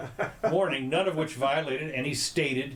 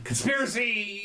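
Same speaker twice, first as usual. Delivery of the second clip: mock cry of conspiracy; 9 semitones higher than usual. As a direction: yelp it like an outraged crowd, with a high pitch but no extra loudness. Mocking people crying conspiracy.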